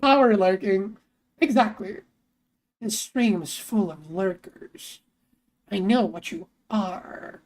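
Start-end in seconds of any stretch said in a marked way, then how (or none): 2.8-7.4 low, slow, knowing; mock ominous. Streamer calling out hidden lurkers, low and mock ominous. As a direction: draw it out with playful suspicion.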